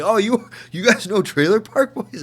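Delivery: light up like a fan meeting another fan; excited Canadian fan. Retold or imitated. Canadians thrilled at the show.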